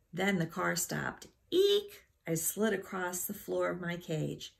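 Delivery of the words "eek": high, loud startled eek; sharp high-pitched rodent yelp; hamster squeal. A narrator's reading. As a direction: squeak out in sudden alarm. Hamster jolted as car stops.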